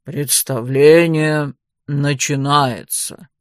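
low, very slow booming announcement. A narrator's reading. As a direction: announce the show, deep and unhurried. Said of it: "Дик добавил немного тише". (чуть тише, низким медленным голосом)

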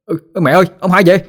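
high excited call to the house. Acted. Boy calls his mother that his grandpa is home, high excited call. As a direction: shout the news up to the house, high. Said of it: "la làng la sớm lên".

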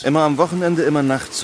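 grumbling complaint. Acted. Inspector grumbles about night call.

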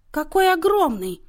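horrified. A narrator's reading. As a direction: recoil at the sheer size of it. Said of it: "ужаснулась матушка". (с ужасом, громко и высоко)

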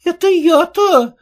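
high, slow astonished question; surprised. A narrator's reading. Peddler woman taken aback by the insult, astonished. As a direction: act stunned at the insult. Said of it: "удивилась торговка".

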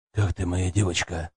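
soft, low fatherly tenderness; fatherly concern. A narrator's reading. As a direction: speak gently like a caring grandfather. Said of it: "С отеческим участием". (отеческое участие)

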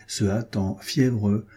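ordinary delivery

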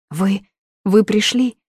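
put-on girl finding her helper, stammering; stammering relief. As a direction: gasp it out relieved.

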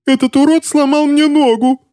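extremely high-pitched, outraged squeal; shrieking. A narrator's reading. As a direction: squeal the accusation in pain. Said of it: "верещал хозяин трости".

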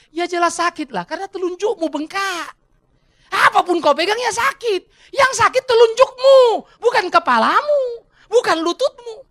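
high, loud, slow emphatic explanation; exasperated doctor. Acted. Doctor telling the patient what really hurts, exasperated. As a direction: spell it out loudly to a stubborn patient. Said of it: "dokternya bilang".

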